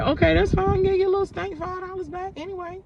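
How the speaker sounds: fake-cheerful, dripping with sarcasm; sarcastic. Grudgingly returning grandma's money.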